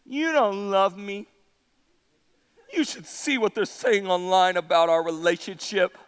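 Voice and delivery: low, subdued mock pout; hammed-up hurt, low and halting at the end; sulking Delilah. Preacher plays Delilah guilt-tripping Samson, sulky and low.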